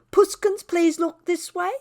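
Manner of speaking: a hint of wheedling baby talk; mock sweet talk. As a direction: lightly mimic a cooing pet owner. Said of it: "all that sweet talking".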